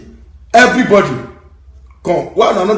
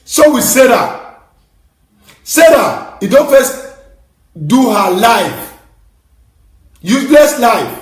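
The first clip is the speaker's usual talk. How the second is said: angry scolding; 5 semitones higher, 4 dB louder, slower than usual. Real, not acted. Scolding rant about Sarah.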